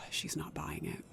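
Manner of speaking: whispered after a sigh. Acted. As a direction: let it out on a defeated whisper. Sigh then hopeless admission.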